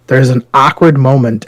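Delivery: ordinary delivery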